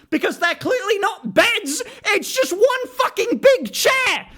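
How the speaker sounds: very high, drawn-out complaint; squealing comic frustration; exasperated outrage. Real, not acted. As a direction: stretch the words in indignant disbelief. Rant over beds being chairs.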